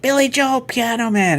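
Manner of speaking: high, eager calling out of requests; imitating eager bar patrons; crowd calling requests.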